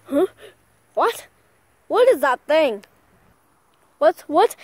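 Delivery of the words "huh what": ordinary delivery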